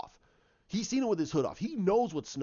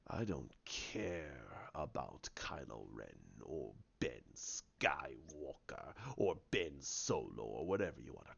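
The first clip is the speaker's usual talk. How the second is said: deep, slow, half-whispered villain rasp; Snoke's voice; 10 semitones lower, 10 dB quieter, slower than usual. Retold or imitated. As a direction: loom over him, low and menacing, breathing the words. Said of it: "He goes".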